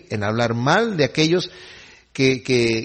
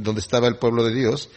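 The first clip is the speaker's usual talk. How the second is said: ordinary delivery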